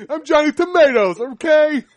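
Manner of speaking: loud, high mock Italian tough guy; Italian accent. Friend hams up his Italian character, in a loud, high mock Italian accent. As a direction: bellow it like a cartoon Italian. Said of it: "I'm an Italian guy now".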